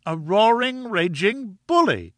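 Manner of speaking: very high, drawn-out insult; furious outburst. A narrator's reading. Wife branding husband a bully, furious outburst. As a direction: climax of a wife's tirade, pitched high.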